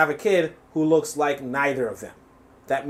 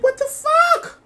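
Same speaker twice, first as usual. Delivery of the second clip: very high squeal of disbelief; mock disbelief; much slower than usual. Host reacting to fans paying for nothing, mock disbelief. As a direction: act baffled, voice shooting right up.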